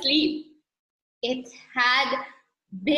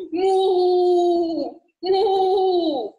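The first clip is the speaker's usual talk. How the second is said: long, loud, higher moos; cow mooing; 5 semitones higher, 5 dB louder than usual. A narrator's reading. Teacher mooing as the cow in a story, loud cow call. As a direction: moo loudly and hold it. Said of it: "said the cow".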